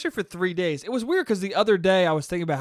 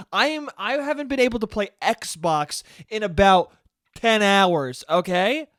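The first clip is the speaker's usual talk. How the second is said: raised-pitch entitled demand, mock outrage; Karen-y complaining; 3 semitones higher than usual. Karen demanding the men in reflective vests, Karen-y complaining. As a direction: play an indignant Karen demanding answers. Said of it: "I was getting real fucking Karen-y".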